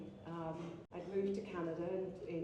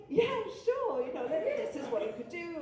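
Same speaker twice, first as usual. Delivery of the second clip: high, loud and laughing; amused funeral home man, much higher and louder; laughing reply; 10 semitones higher, 8 dB louder than usual. Retold or imitated. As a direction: answer through big laughter. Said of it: "he laughed his head off and he said".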